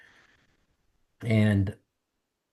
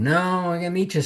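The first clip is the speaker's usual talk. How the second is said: higher-pitched, imitated objection; retold insistence; 7 semitones higher than usual. Wife turning down meeting at home, mildly insistent. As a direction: voice the wife overruling the suggestion.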